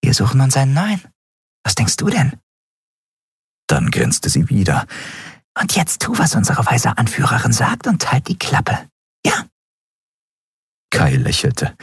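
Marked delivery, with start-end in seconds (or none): 0.0-2.4 put-on high, flippant girl voice; cheeky girl. A narrator's reading. Narrator voices cheeky girl Orrin. 5.6-9.5 put-on high, bossy girl voice; cheeky girl. A narrator's reading. Narrator voices cheeky girl Orrin.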